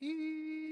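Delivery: long, thin, high "eee" squeal; high-pitched ringing. Retold or imitated. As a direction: imitate ringing in silence, held high. Imitates ringing noise in silent room.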